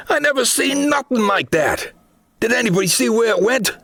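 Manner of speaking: high, alarmed soldier voice, rushing the question; Miller voice. Acted. Miller asking the others where the creature went, high and jittery. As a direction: keep the panic up high and quick at the end.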